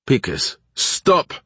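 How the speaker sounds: sharp, high-pitched call to stop; called-out order. A narrator's reading. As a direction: call out to halt him, pitch leaping on the command. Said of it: "Baklanov called".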